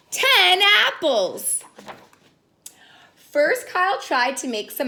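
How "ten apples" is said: high, slow amazed finish; counting apples with children; excited reveal. Acted. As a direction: land the big number.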